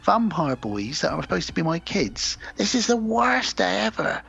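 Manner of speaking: high, hammed-up complaint; exasperated bride. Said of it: "And she's like".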